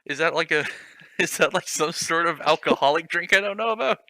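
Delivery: ordinary delivery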